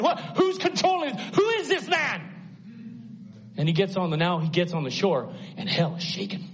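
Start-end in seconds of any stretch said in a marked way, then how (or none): 0.0-2.2 high, rising panic of shocked demons; preacher voicing demons in sudden alarm, high and frantic; stunned demons. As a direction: voice the devil's crew in disbelief.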